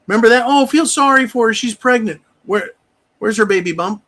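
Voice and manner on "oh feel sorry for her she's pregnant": mock pity. Mocking the public's sympathy.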